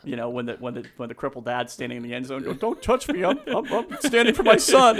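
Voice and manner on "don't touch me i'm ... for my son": loud, high, defiant cry; impassioned movie dad. Retold or imitated. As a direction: shout it like a father refusing help.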